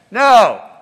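one loud, cranky shouted word; snippy yell. Retold or imitated. Tired fisherman yelling back to the stranger on shore, loud and snippy. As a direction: bark it back, cold and annoyed. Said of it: "yells this back".

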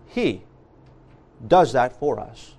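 "He" set alone, then a pause and a low, quiet rest; stress on HE. Shown as a demonstration. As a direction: let "He" stand by itself before the rest. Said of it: "emphasis should be placed on the shepherd".

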